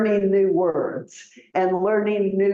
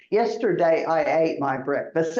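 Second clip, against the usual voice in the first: ordinary talk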